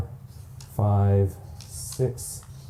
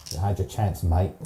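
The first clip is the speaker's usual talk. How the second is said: put-on Australian accent; 3 semitones lower than usual. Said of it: "Killaroo has a, an Australian accent".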